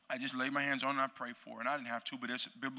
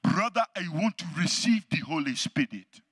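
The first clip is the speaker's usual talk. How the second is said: louder, imitated African accent; earnest African accent, a touch louder; 3 semitones higher, 8 dB louder than usual. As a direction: voice the African evangelist with his African accent. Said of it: "It's my African voice".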